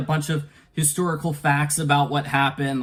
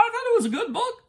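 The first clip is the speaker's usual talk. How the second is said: laughing; faster than usual. Real, not acted. Half-laughing backpedal on his criticism.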